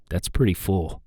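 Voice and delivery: low, measured voice right on the mic; close-mic meditation voice. Shown as a demonstration. Vlogger demonstrating proximity effect up close, guided-meditation voice. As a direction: drop down low as if guiding a meditation. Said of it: "like, a guided meditation".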